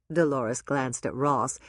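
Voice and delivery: normal talking voice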